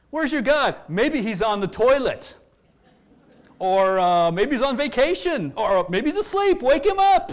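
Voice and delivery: high, loud, sing-song jeering that climbs to a shout; preacher voicing Elijah mocking the prophets of Baal; mocking taunt. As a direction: taunt them like a schoolyard bully. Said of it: "Elijah mocked them".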